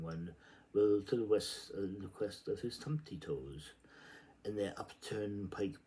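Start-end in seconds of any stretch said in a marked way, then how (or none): none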